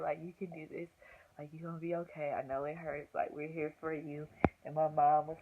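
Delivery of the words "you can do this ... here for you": soft, hushed encouragement; imitated whispering. Boyfriend comforting her in labor, imitated soft whispering. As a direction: murmur reassurance close to the ear. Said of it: "whispering in my ear".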